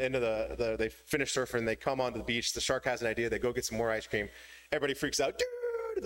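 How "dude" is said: very high shrieked dude; freaked-out dude. Acted. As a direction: crowd screams dude in fright. Everybody freaks out.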